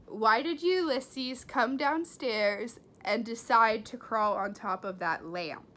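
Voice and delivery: ordinary delivery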